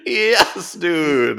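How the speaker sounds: high, loud cheer; excited cheer. Real, not acted. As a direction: cheer it out, thrilled. Cheering the jellyfish move.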